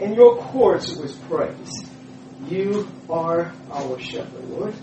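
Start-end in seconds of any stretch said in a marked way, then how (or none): none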